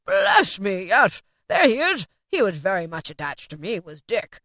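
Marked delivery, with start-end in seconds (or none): 0.0-2.1 louder, wondering exclamation; happily amazed Scrooge; delighted recognition. A narrator's reading. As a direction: marvel aloud at an old friend. Scrooge delightedly spots old friend.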